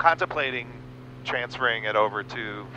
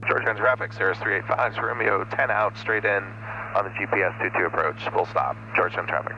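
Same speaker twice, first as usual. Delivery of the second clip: crisp, louder pilot radio call; 4 dB louder than usual. Real, not acted. Pilot announcing his position to Georgetown traffic, crisp radio call. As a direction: read it out like a pilot on the frequency.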